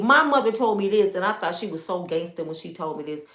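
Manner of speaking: usual speaking voice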